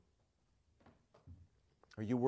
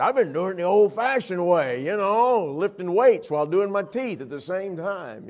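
mock indignant joking; 8 semitones higher, 10 dB louder than usual. Husband joking to wife.

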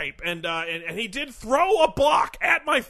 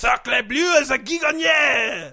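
put-on French accent; slower than usual. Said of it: "Guy Gagné, what do you got for us?".

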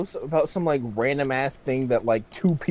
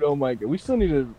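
ordinary delivery

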